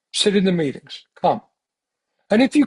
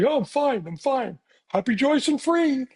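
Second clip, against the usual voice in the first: put-on chirpy reassurance, lifting higher at the end; mock cheerfulness; 5 semitones higher than usual. Member lying to the group about being okay, mock cheerfulness. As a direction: pretend all is fine, lightly and falsely. Mocks pretending everything is okay.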